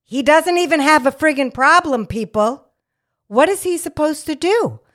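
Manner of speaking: high and loud friggin' problem; outraged. Real, not acted. Nurse defending the caller to listeners, high and outraged. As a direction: hammer the unfairness home.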